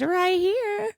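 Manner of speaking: high, lilting "here I am" reply; playful retold answer. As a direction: answer high in a mock lovestruck voice. Acted answer to where's my man.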